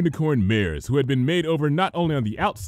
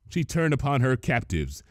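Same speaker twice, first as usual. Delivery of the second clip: ordinary delivery, nothing marked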